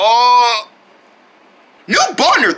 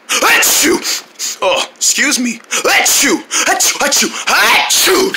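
sneezing fit; 3 semitones higher, slower than usual. Acted. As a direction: sneeze again and again.

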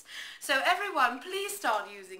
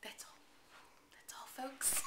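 soft whispered "That's all, folks" through a laugh; 13 dB quieter than usual. Real, not acted. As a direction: breathe "That's all, folks" out while giggling. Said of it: "Just like the Porky Pig would say".

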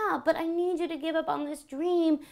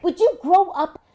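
angry; 5 semitones higher, 9 dB louder than usual. Acted. Wife scolding husband.

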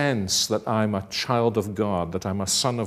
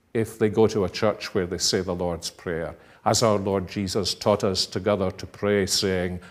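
normal talking voice

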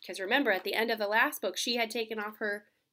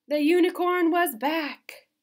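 louder, slow, higher announcement; triumphant reveal; 5 semitones higher, 12 dB louder, slower than usual. Acted. Reading the unicorn's return to kids, triumphant. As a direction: proclaim it like a fanfare.

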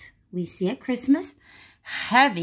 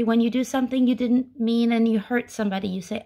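ordinary talk, nothing marked

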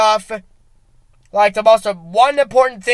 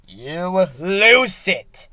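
drawn out; 4 semitones lower, much slower than usual. Acted. Slowed dramatic repeat.

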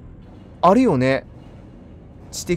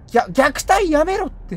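mock outrage; 12 semitones higher, 4 dB louder, slower than usual. Real, not acted. Being ganged up on.

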